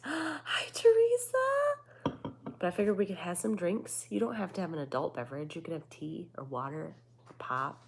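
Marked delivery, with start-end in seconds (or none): 0.0-2.2 very high, drawn-out hello; high sing-song greeting. Real, not acted.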